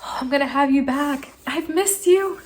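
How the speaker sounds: high, adoring gush; excited. Retold or imitated. Owner to her missed computer, excited. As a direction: coo it high to a long-missed friend. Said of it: "I was just so excited".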